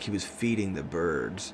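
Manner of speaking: soft, hushed sexy reading voice. Pretend. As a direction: read it low and seductive. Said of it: "in, like, a sexy voice".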